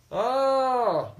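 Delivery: loud, high mock exclamation; exaggerated sarcastic oh. King mocking the slow bearer, loud sarcastic oh. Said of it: "he was very sarcastic".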